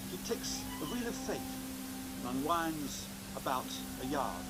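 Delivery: measured showman narration over music; magician's stage patter. Acted. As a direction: present each trick with a flourish. Magic show presenter patter.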